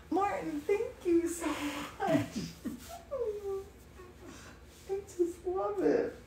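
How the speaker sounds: high, soft, overcome gratitude; real tender thanks dissolving into a small laugh; emotional. Real, not acted. Thanking Martin for the painting, softly emotional. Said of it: "I'm so emotional".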